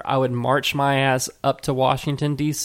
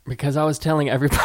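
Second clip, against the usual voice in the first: normal talking voice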